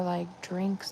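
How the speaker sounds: normal talking voice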